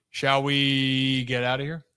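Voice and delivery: normal talking voice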